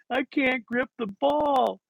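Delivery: slow, high-pitched wail; mock crying child. Crying boy confessing to his coach, slow mock wail. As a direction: draw it out like a kid in tears. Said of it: "I just broke down crying".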